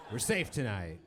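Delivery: usual speaking voice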